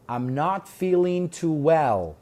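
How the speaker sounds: I'm not feeling too well, very slow and even; slow model line. Shown as a demonstration. Teacher to class: slow model of the line. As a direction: drill the line at half speed. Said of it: "model reading, slow".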